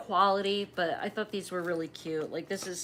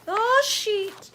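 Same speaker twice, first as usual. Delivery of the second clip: sheet popped much higher and falling; playful emphasis; 13 semitones higher, 6 dB louder than usual. Acted. Crafter showing viewers the sticker sheet, squeaky high.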